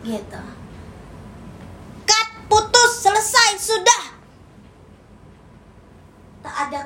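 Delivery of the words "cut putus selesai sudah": emphatic breakup. Acted. Betrayed lover cutting off a partner, emphatic and higher. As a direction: spit out each word as a final verdict.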